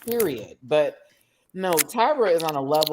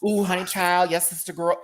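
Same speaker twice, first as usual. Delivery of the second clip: lightly imitating Tyra's put-on sassy voice; a hint of a sassy sister-girl voice; 3 semitones higher than usual.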